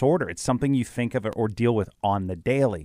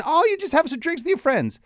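mocking voice. Mocking a common saying.

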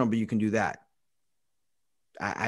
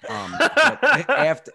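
laughing; 11 semitones higher, 7 dB louder, slower than usual. Real, not acted. Joking with friends on podcast.

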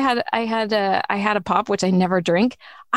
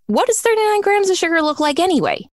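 higher, fast incredulous question; rushed amazed question; mock amazement; 8 semitones higher, faster than usual. Real, not acted. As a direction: ask it in disbelief.

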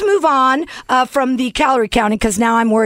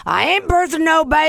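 higher-pitched mock film quote; movie line impression; 4 semitones higher than usual. Host teasing guest with a film line, put-on higher voice. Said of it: "Gone with the Wind".

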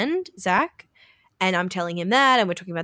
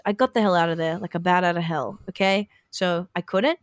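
ordinary delivery

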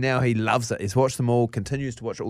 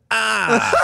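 high, loud mock "ah!"; play-along pain yell; 11 semitones higher, 5 dB louder than usual. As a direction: fake a yelp of pain for the child. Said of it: "then I have to go".

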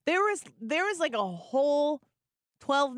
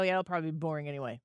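fast throwaway; 7 semitones lower, 6 dB quieter, much faster than usual. Retold or imitated. Retold own dismissive remark.

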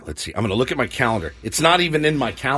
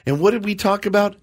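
ordinary delivery

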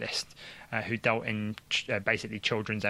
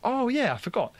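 mock casual doctor; 12 semitones higher than usual. Doctor casually forgetting key assessment.

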